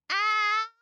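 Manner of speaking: ordinary delivery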